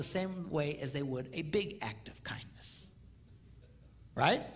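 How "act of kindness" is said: whisper. Shown as a demonstration. Whispered repeat of phrase.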